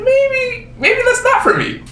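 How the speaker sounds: very high, stretched-out little voice; squeaky retreat. Acted. Quitting jiu-jitsu after getting choked out, comic high squeak.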